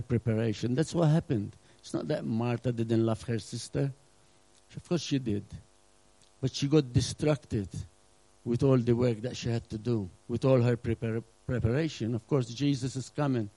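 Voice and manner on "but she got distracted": usual speaking voice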